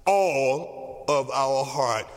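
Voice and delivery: all drawn out, voice dropping low on heart; slow, low emphasis on all of our heart; stress on all. Shown as a demonstration. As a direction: hit all slowly and heavily. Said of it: "And I want to emphasize".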